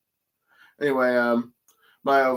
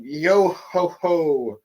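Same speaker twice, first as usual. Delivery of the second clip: slow, drawn-out higher-pitched chant; pirate catchphrase; 5 semitones higher, much slower than usual. Retold or imitated. Retelling Brook's catchphrase to young Gunko, slow and singsong. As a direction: stretch out each syllable playfully. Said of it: "as he's going".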